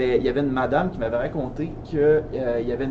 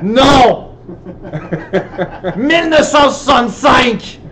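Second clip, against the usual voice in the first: radio caller shout; 8 semitones higher, 8 dB louder, slower than usual. Retold or imitated. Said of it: "il y avait comme un vide".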